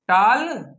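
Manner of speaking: टाल drawn out slowly with open "aa"; wrong vowel demo. Shown as a demonstration. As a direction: say टाल slowly as "taal", the mistake to avoid. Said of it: "टाल नहीं".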